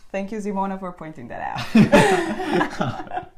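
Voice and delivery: thanks breaking into loud laughter; laughing thanks. Real, not acted. Host thanks a colleague for pointing out the haircut, laughing. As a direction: let the last words burst into a real laugh.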